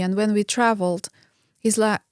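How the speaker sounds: normal talking voice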